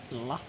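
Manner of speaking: "la", a lazy like with the end dropped. Shown as a demonstration. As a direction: say "la" short and soft, no k sound. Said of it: "too lazy to say like".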